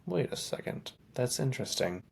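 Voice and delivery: high, near-whispered double take; mock surprise. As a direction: act like a sudden realisation in a breathy hush. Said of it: "You're like".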